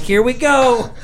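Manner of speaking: high, tense "here we go"; mock dread before the turbulence; nervous dread. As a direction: brace yourself for it. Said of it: "I'm like".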